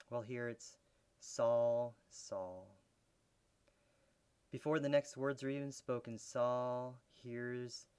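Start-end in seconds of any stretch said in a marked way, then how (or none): 1.2-2.7 voice of grief. Retold or imitated. Jesus calling to Saul in grief and disappointment. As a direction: let the name fall away in sorrow. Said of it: "the voice of disappointment, of grief".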